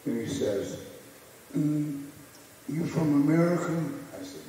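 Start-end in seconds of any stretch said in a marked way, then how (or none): 1.6-3.8 teasing Ali voice, pitched up; Ali impression. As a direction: talk down to him like the champ.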